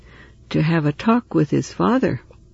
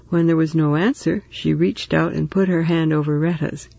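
ordinary delivery

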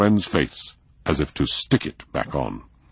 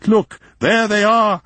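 high, loud, slow excited call; excited shout; 6 dB louder than usual. A narrator's reading. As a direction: call out to them with delight. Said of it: "shouted von Eaglefeld excitedly".

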